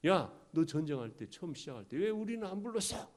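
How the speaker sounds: indignant, high-pitched protest; angry complaint. Acted. Ephraim confronting Gideon about being left out, indignant. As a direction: demand an answer, pitch climbing sharply.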